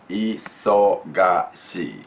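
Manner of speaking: isogashi stretched out slowly, each syllable clear; slow, careful isogashi. Shown as a demonstration. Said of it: "Just we wanna say it".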